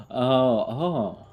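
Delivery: ordinary talk, nothing marked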